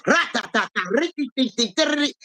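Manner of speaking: high, rapid nonsense syllables standing for fast talk; rapid mock chatter. Showing how he talked the man down, rattled off fast and high. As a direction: rattle it off like slick nonstop talking. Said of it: "I'm in that hoe talking".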